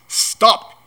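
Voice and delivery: sudden high, loud order; blustering, forceful halt; commanding shout. A narrator's reading. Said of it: "he commanded".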